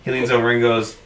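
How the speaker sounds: ordinary talk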